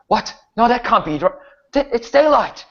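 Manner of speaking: a guard losing his nerve in daylight; terrified guardsman. Acted. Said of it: "one of them says".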